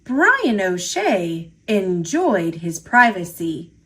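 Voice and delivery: plain reading voice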